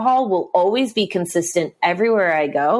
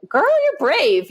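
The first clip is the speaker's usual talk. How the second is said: put-on impressed girls; 11 semitones higher, slower than usual. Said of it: "they'd be like".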